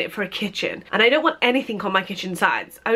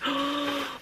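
gasp; 5 semitones higher, 9 dB quieter than usual. Real, not acted. Seeing the teapot.

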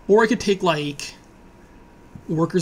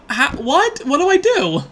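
usual speaking voice

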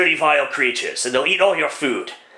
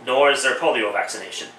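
normal talking voice

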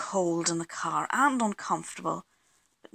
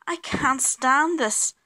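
higher, louder, slow complaint; exasperated bear; 6 semitones higher, 5 dB louder, slower than usual. A narrator's reading. Mister Bear hears the aeroplane noises, exasperated. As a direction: drag out each word in protest.

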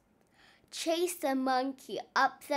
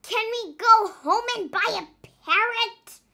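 higher, louder pirate voice asking; hopeful pirate question; 8 semitones higher, 8 dB louder than usual. Acted. Nong asking the captain for a parrot, higher and hopeful. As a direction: ask hopefully, pitched up and loud.

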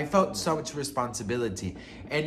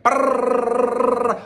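high, loud rolling brrr; loud rolled-lip raspberry, mocking; mock tongue trill; 11 semitones higher, 10 dB louder than usual. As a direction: buzz your lips like a wild tongue. Imitating his kiss.

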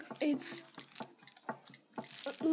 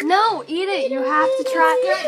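high, loud demanding; insistent shouting; 5 semitones higher, 8 dB louder than usual. Real, not acted. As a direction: badger her to eat it. Insisting sister eat it.

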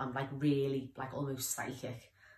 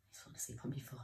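hushed whisper so the dog can sleep; soft unvoiced whisper over a sleeping dog; 2 semitones lower, 9 dB quieter, faster than usual. Real, not acted. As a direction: whisper softly, don't wake him. Dog falling asleep on foot.

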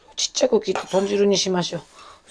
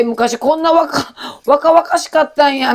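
mock amazement; 6 semitones higher, 4 dB louder, slower than usual. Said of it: "みたいな".

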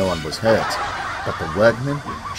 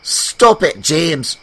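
cross; 7 semitones higher, 6 dB louder, much slower than usual. Acted. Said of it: "replied Emily crossly".

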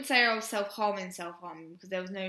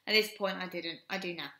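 normal talking voice

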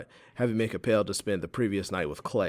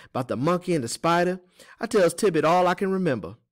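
high, loud character voice; folksy Teeny, proud storyteller; 9 semitones higher, 7 dB louder than usual. Acted. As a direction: recall mother's stories in a high folksy voice.

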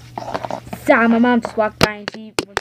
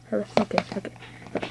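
ordinary delivery

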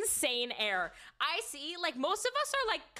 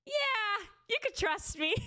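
higher, laughing mock promise; a guide's laughing yes, much higher; laughing reassurance; 10 semitones higher than usual. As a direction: imitate him laughing while promising. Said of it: "And he goes".

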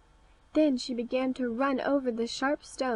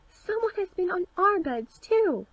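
much higher, excited dwarf chorus cry; cried out; 8 semitones higher, 4 dB louder than usual. A narrator's reading. The other dwarfs answering their brother, excited high cry. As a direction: dwarfs running up, calling out their discovery. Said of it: "and cried".

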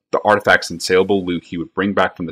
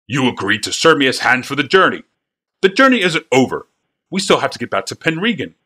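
angry wagon master; 6 semitones higher than usual. A narrator's reading. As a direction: protest like an outraged boss. Wagon master arguing with boys.

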